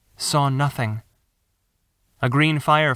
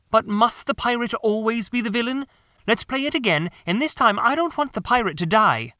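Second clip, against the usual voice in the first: put-on high boy voice, arguing his case; a boy wanting the game replayed; young boy pleading; 8 semitones higher than usual. John asks to replay the game without the pirate dying, high boy voice.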